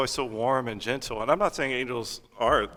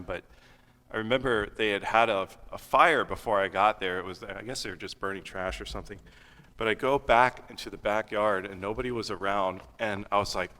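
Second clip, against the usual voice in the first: normal talking voice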